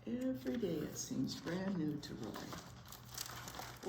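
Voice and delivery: soft repeat. Shown as a demonstration. Teacher softly repeats the story's last line. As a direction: hush the line for the ending.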